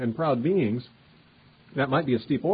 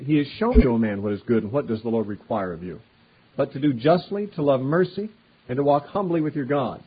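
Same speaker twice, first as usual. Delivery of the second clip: louder, measured reading of the verse; scripture reading; 4 dB louder than usual. Acted. Preacher reading what the Lord requires, raised and measured. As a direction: lay the verse out with weight.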